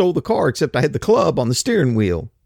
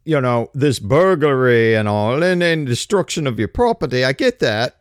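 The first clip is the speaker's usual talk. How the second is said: put-on lightly done British bobby accent, slowed in places; a hint of a cockney policeman, fussy and officious; mock British cop; 4 semitones higher than usual. Cop to a burglary victim, a light mock British accent. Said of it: "y'all are more likely to look around and go".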